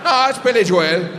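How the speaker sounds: firm Irish correction. Retold or imitated. Irish sound guy insisting to comic, firm Irish. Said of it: "And he goes".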